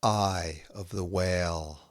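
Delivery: slow, measured haiku reading with long pauses between poems; poetry reading. Acted. Poet reading her own haiku to listeners, slow and measured. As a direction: let each image land before the next.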